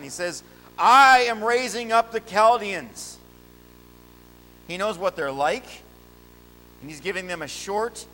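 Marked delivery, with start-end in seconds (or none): 0.8-3.2 booming proclamation. Acted. God's voice in sermon.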